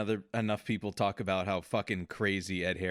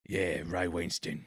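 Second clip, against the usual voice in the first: put-on slow, lower Cockney accent; Cockney impression; 5 semitones lower, slower than usual. Imitating the actor from The Departed, low slow Cockney. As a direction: stretch the vowels into thick Cockney. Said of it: "I think it was more like".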